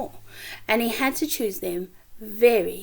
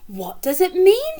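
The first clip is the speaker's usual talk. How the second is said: puzzled Missus Silver; 8 semitones higher, 5 dB louder than usual. Acted.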